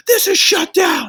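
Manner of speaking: high, drawn-out mock shout; John Taffer. Storming into a filthy bar to close it, hammed-up yell. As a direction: burst in yelling like an outraged bar inspector. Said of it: "felt like John Taffer was gonna walk in and be like".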